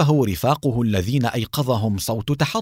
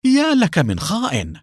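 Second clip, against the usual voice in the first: high, shocked exclamation; astonished cry; 6 semitones higher than usual. A narrator's reading. Knight jumped by the officer he trusted, crying out astonished. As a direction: blurt it out high, caught off guard. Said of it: "صاح بدهشة".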